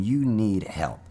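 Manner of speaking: normal talking voice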